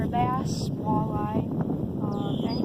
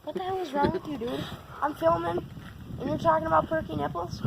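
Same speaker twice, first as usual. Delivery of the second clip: exasperated; 6 semitones higher than usual. Real, not acted. Scolding brother mid-filming.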